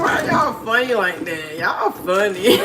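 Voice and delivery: slow, high laughing "y'all funny"; helpless laughing at the chat. Real, not acted. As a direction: crack up at the chat. Laughing at viewers' comments.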